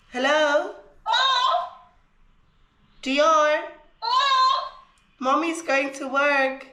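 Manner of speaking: high, bright calling to a pet. Real, not acted. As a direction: call the puppy sweetly. Calling her dog.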